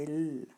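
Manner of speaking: "L" as a held lll sound; letter sound L. Shown as a demonstration. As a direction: hold the consonant in "L", lll. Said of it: "le son est".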